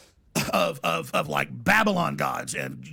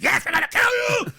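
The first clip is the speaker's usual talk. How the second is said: high-pitched, hammed-up killer's yell; menacing executioner scream; 4 dB louder than usual. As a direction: shriek the threat theatrically. Acts the killer answering victim.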